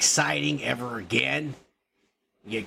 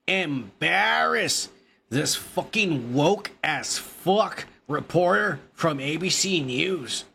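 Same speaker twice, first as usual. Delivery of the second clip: ordinary talk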